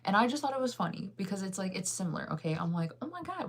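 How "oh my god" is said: high-pitched mock gasp of delight; excited gush. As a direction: play up the excitement in a high, light voice. Acting out her own excited reaction.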